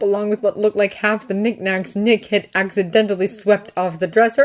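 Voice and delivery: ordinary delivery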